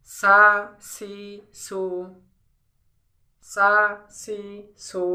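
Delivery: সা, সি, সু with a straight, thin s. Shown as a demonstration. Teacher drilling sin for learners, straight s sound. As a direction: drill সা, সি, সু one at a time, pure s. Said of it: "একদম straight".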